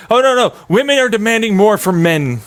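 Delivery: mock protest. Voices an objection about women's demands.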